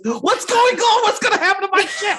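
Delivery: high-pitched, hammed-up panic; panicking captain in a crisis; frantic orders.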